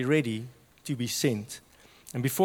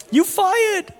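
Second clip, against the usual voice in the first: much higher, loud mock yell of a boss; boss fires him; 9 dB louder than usual. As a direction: bark the firing like an outraged boss.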